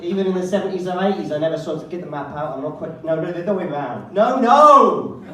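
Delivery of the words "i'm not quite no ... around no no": mock Bond map argument. Bond arguing about the map.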